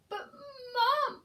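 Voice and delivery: high, loud frightened gasp. Acted. Eddie gasping as Mum leaves him alone. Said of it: "gasped Eddie".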